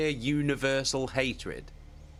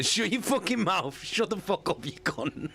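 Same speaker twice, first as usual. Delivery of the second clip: mock angry telling-off; 7 semitones higher than usual. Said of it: "it proper gave him the".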